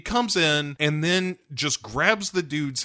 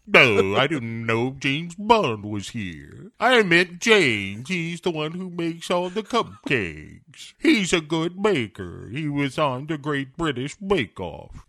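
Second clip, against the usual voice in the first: hammed-up villain; 4 semitones higher than usual. Acts Blofeld answering Bond's shouts.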